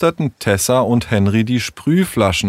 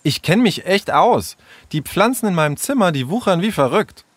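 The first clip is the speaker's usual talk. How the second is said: high-pitched, huffy bragging; eingeschnappt; 9 semitones higher than usual. Acted. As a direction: defend yourself, miffed. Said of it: "rief Sarah eingeschnappt". (beleidigt und eingeschnappt)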